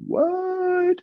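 very high, incredulous what; mock-baffled, soaring question; retold astonishment. Student hearing the coach's odd method, exaggerated astonishment.

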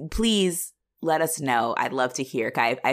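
ordinary talk

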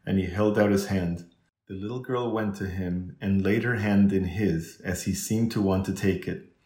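usual speaking voice

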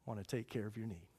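usual speaking voice

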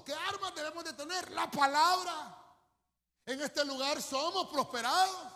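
loud, high preaching shout; preaching climax, belting it out; full-force preaching. Real, not acted. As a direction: thunder it to the congregation.

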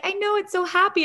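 sweet and high; sweet doctor voice. Retold or imitated.

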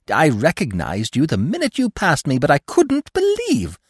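high squeaky chattering squirrel; excited squirrel. A narrator's reading. Squirrel meeting old Bambi, high and eager.

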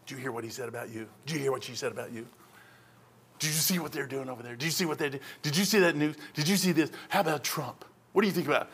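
low hushed voice of a seducing spirit; tempting whisper. Acted. Preacher voicing seducing spirits, hushed and tempting. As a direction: murmur the temptations softly, like a voice in the ear.

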